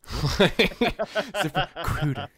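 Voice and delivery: very slow, high, laughing mock plug; laughing drawn-out ad read. Friend mocking a host's ad read, slow and laughing. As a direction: stretch the name out slowly through a laugh. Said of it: "but she goes".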